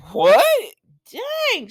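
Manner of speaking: loud what, then high-pitched dang; hammed-up disbelief at juicy news; acted shock. [name] hearing of a friend's cheating husband, shocked.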